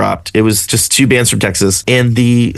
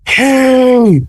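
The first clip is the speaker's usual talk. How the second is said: high, loud stranger calling out across the street; a stranger shouting across a parking lot at a guitar case sticker, high and loud; mock yell; 13 semitones higher, 3 dB louder, much slower than usual. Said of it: "Somebody yells at me".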